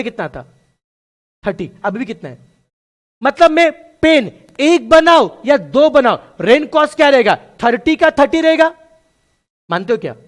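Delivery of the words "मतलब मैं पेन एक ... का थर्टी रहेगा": louder, higher, slow emphatic explanation; loud slow teacher emphasis; emphatic teaching. Real, not acted. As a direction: drive the point home to the class. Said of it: "teacher stressing point".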